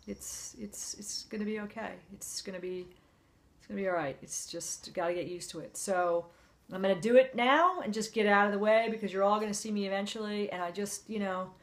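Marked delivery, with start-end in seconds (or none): 0.1-5.8 quiet, fragile, fighting tears; much softer, trying to stay strong; holding back tears. Real, not acted. Facing chemo, voice drops.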